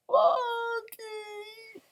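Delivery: faint, high, whispery call; hoarse calling. A narrator's reading. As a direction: call out with a worn-out voice. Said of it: "until my voice was hoarse".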